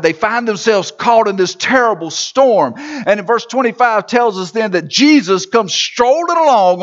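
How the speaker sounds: full-voiced sermon narration; preaching. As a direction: preach the story out to the hall. Sermon storytelling at full force.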